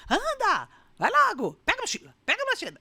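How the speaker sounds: high, loud rushing shouts; frantic yelling. Retold or imitated. Mother late for school shouting at her child, frantic. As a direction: bark orders at the child, hurried.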